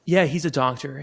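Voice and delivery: ordinary talk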